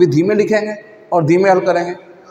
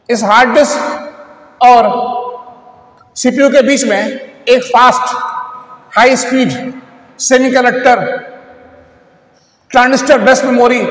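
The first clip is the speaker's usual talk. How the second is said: deliberate buildup; 4 semitones higher, slower than usual. Real, not acted. Building up to the answer.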